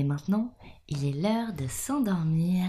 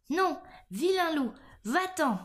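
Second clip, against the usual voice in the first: frightened little pig's cry; 10 semitones higher than usual. A narrator's reading. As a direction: cry out high, like a scared piglet. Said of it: "s'écria le petit cochon effrayé". (petit cochon effrayé, voix aiguë)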